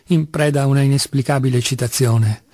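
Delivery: ordinary delivery, nothing marked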